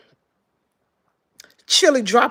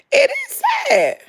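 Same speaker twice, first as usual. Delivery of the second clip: much higher, wailing mock sorrow; theatrical high sob of pity. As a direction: cry it out in hammed-up grief. Mock grief over ticket sales.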